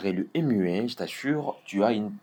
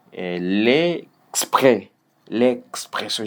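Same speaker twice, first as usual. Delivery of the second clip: "l'expression" as "lé... xpression"; pause before consonants; slower than usual. Shown as a demonstration. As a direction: break before "kspr" in "l'expression". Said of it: "mettre la pause avant ou après". (« l'expression » avec la pause avant les consonnes)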